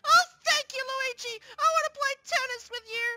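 high, loud delighted squeal; overjoyed. Acted. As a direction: thank a friend in squealing delight. Delighted by tennis invitation.

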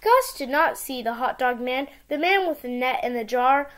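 ordinary delivery, nothing marked

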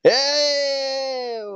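high strained wail; distressed cry. Real, not acted.

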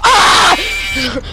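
high frightened scream; sudden shriek of terror. Real, not acted. Trapped player screaming in fright. Jump scare in game.

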